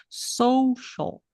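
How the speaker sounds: "Social" with SO stressed, falling; stressed first syllable. Shown as a demonstration. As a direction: lean on the SO of social. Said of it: "Vamos colocar aqui sublinhado".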